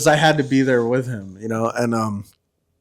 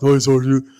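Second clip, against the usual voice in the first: lightly imitated dim-witted soldier; a hint of a simple, obedient private; Chavez; 3 dB louder than usual. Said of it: "that's how he talked".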